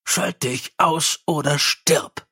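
slow growled threat. Acted. Mumm threatens the chiming imp, slow growl. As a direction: growl it out slowly, meaning it. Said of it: "knurrte er".

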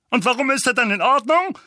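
high, loud, angry challenge; Onkel Vernon, flaring up. A narrator's reading. Uncle challenges Harry about his son, flaring up. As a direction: explode with an indignant question. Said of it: "brauste Onkel Vernon auf".

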